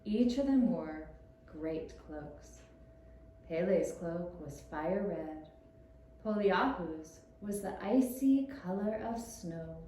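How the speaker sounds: ordinary delivery, nothing marked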